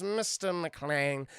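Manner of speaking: slow, drawn-out mock German accent; imitated German villain, very slow. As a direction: savour the name in a put-on German accent. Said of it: "he starts speaking the Deutsch".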